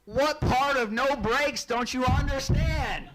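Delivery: furious dad asking how this happened; dad's exasperated rant. Retold or imitated. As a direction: drag out the disbelief like a fed-up dad on the radio. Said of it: "my dad comes over the radio at that moment and goes".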